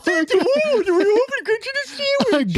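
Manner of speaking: very high, loud, garbled parroting; mocking deaf guy voice. Shown as a demonstration. Thanking a friend for a sandwich in mocking deaf guy voice. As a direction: squeal it back, high and mangled. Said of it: "you say that when they're just being genuinely nice".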